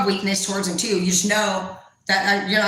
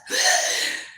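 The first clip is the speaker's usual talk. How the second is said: sharp mock gasp; horrified gasp. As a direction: gasp as if losing your breath. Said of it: "they literally lose their breath".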